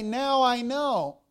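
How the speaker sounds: "Now I know" slowed, with NOW leaned on; stress on NOW. Shown as a demonstration. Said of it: "Why does he say".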